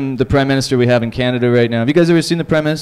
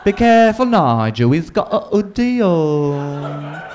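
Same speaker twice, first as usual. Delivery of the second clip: slow, fussy English voice; mock English accent; slower than usual. English people warning Nigel about the hoodie, in a slow mock English accent. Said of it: "They'll be like".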